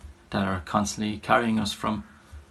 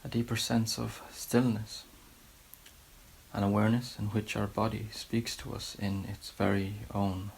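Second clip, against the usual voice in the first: softer, unhurried meditation guidance with long pauses; guided meditation; 6 dB quieter than usual. Real, not acted. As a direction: gently settle listeners into a body scan, leaving space between phrases. Leads guided body scan meditation.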